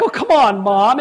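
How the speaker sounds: mock-exasperated appeal; exasperated protest. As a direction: protest to Mom, raising the voice. Retold protest at mother's card.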